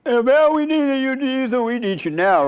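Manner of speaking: high, loud mock panic; panicked disciples. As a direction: cry out to Jesus in a hammed-up fright. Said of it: "They were like".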